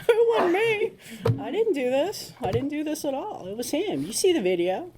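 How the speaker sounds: hammed-up high protest of innocence; mock innocent pleading. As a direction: play the wide-eyed innocent denying it all. Mock innocent denial to police.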